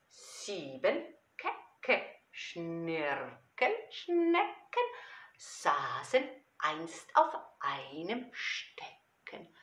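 playful, slow, drawn-out verse with big swings; children's poem recitation. Acted. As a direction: recite it theatrically for small children.

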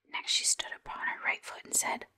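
usual speaking voice

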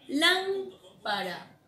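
"Lámpara" lenta y aguda, golpe en "Lám"; acento en LÁM. Shown as a demonstration. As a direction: estirar "Lámpara" marcando la primera A. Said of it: "La primera A". ("Lámpara" con la sílaba tónica resaltada)

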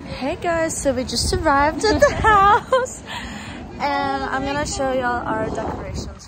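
high, loud, gushing vlog greeting. Real, not acted. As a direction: burst in all sparkly and loud.

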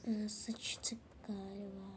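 slow, hushed uh-oh; whispered dread. Acted. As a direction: whisper it slowly, caught out. Caught student whispering dread. (yakalanan öğrencinin yavaş fısıltısı)

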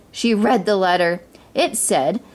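ordinary delivery, nothing marked

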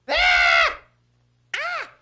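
short high shriek; sudden sharp scream; startled scream. Pretend. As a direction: yelp in fright at a jump scare. Startled on finding granny.

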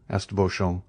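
usual speaking voice

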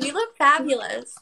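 ordinary talk

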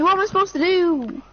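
ordinary talk, nothing marked

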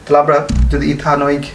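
ordinary talk

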